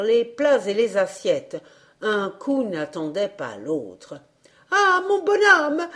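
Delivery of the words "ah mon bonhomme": high, loud pleading hostess; crying out. A narrator's reading. Hostess begging the old man as blows fall, crying out high. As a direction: plead in a high wailing cry. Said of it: "cria l'hôtesse".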